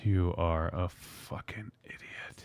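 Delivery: whisper. Acted. Mock insult in banter.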